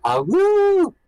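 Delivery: short, very high, loud wolf howl. Acted. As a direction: throw your head back and howl. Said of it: "I will try as well".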